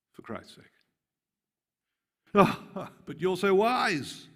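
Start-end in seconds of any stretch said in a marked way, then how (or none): 2.3-4.3 high, loud and slow mock praise; mocking sarcasm. Paul undermining their pride, slow high sarcasm. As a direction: draw out the fake flattery. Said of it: "He's mocking them".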